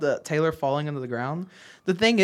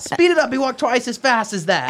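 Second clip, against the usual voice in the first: high, loud reenacted command; shouted stage line; 8 semitones higher, 4 dB louder than usual. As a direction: belt out the line to startle. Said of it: "and then I shout".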